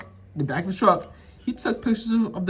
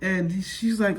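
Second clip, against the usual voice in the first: usual speaking voice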